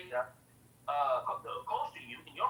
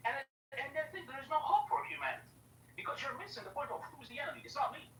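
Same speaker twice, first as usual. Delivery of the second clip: heated rant; 8 semitones higher, faster than usual. Real, not acted. Heated rant, fast and raised.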